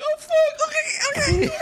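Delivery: high surprised giggly yelp; imitating caught friend. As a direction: caught red-handed, squeak it out. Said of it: "He's like".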